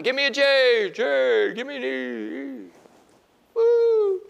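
high, loud mock cheer; excited crowd cheering for Jesus; cheerleading shout. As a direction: chant it like a pep rally. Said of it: "They're shouting".

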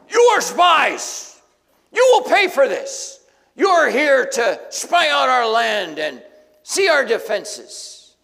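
high, loud furious accusation; enraged boss roar. Acted. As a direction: roar it at them like a tyrant. Joseph accuses the brothers.

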